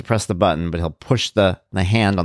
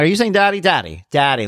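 eager coaxing; 8 semitones higher than usual. Retold or imitated. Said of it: "I'm like".

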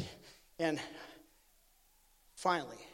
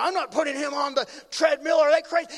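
loud, high shout building in force; incredulous mock yelling, climbing to the end; angry refusal; 6 semitones higher, 9 dB louder than usual. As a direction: imitate an outraged doctor refusing. Acts out doctor's angry refusal.

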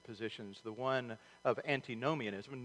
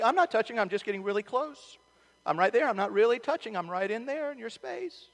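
whiny little sibling; 9 semitones higher, 6 dB louder than usual. Retold or imitated. Brother defends himself to mom.

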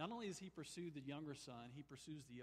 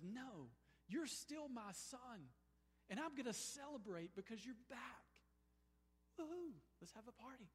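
joyful father; 8 semitones higher than usual. Retold or imitated. Father welcoming younger son back, high and joyful. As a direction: lift him up with joy.

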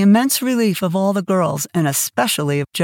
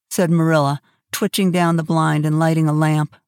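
ordinary delivery

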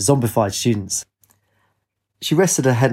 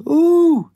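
very high, loud sustained "ooh"; high-pitched hooting shriek; 6 dB louder than usual. A narrator's reading. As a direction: shriek a long piercing ooh. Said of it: "she heard a shrieking".

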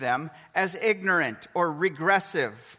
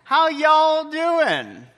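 loud, high, stretched-out Texas greeting; Texas accent; 8 semitones higher, 6 dB louder, much slower than usual. Retold or imitated. Speaker imitates how everyone in Texas says hello, big Texas accent. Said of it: "in Texas that everyone would say".

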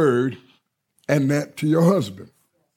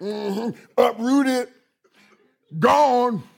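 knowing hum, then higher, gloating; smug triumph; 6 semitones higher, much slower than usual. Retold or imitated. Pastor gloating over his daughter's ex-suitor, higher and smug. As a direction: relish each word like a secret victory.

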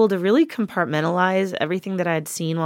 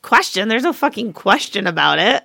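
higher-pitched mock outrage; hammed-up exasperated comeback; indignant retort; 4 semitones higher, 5 dB louder, faster than usual. Patient retelling her comeback to the nurse, mock indignant.

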